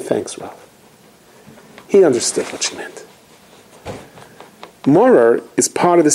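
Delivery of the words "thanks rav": quiet thanks. Retold or imitated. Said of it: "she said".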